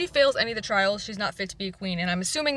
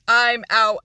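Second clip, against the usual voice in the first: loud; 10 dB louder than usual. Retold or imitated. Acted refusal, burst of volume.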